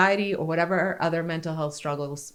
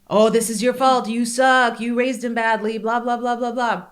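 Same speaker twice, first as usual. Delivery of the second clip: higher, louder mocking accusations; insecurities screaming; 5 semitones higher, 5 dB louder than usual. Mother's insecurities blaming her for her son. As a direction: jeer like a nagging inner critic. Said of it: "my insecurities are screaming at me".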